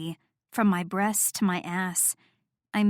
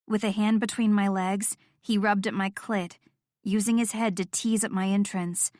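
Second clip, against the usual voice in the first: plain reading voice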